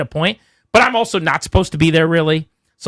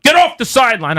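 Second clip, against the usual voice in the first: retold yell; 7 semitones higher, 3 dB louder than usual. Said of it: "he kept yelling at me".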